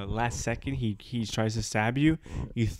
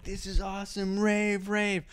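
mock party excitement; 8 semitones higher than usual. Acted excited partygoers at rave.

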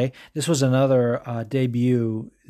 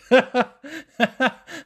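high cackling laugh at his own joke; burst of laughter; 12 semitones higher than usual. Real, not acted. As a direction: crack up laughing, high-pitched. Laughs after own bit.

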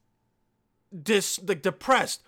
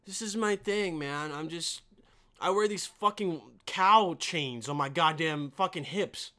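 normal talking voice

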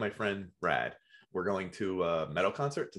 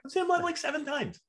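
very high, defensive protest; indignant. Real, not acted. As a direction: squeak it out in outrage. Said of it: "defending his fandom".

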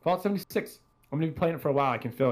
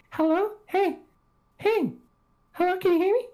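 put-on high, piping kid calling into the mic; little kid voice. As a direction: play an eager little kid. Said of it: "Some random little kid".

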